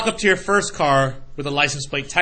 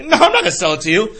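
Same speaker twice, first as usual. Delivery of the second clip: mock refusal; 7 semitones higher, 6 dB louder, faster than usual. Owner refusing again.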